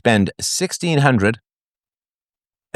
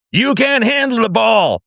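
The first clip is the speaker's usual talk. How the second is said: Nicholson shout parody; 9 semitones higher than usual. Acted. Host parodying Jack Nicholson's famous line, shouting. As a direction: belt it like the courtroom scene.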